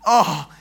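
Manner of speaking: loud put-on groan; hammed-up groan of soreness; pained groan. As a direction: complain with an achy mock groan. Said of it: "I'm groaning".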